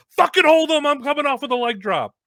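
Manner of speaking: loud, high-pitched barked command; Kevin Graham yelling. Retold or imitated. Colleague setting up a leg drop, yelling. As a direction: shout orders mid-action. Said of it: "Kevin Graham's yelling".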